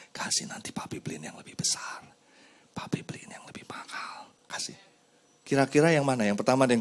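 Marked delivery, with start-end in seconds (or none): 0.1-4.7 hushed coaxing whisper; father whispering a bribe to his son; soft coaxing. Retold or imitated. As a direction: whisper a promise to the child. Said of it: "Atau kita ngomong sama anak kita".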